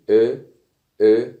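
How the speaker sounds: "أو" as loud rounded ö; Turkish ö demo. Shown as a demonstration. Teacher reviews o with two dots, loud rounded ö. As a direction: punch out "أو" as eh through rounded lips. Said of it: "نفس الحرف عليه نقطتين".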